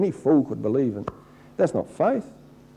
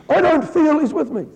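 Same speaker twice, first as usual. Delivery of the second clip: mock whine; 12 semitones higher, 3 dB louder than usual. Mocking a complainer's whine.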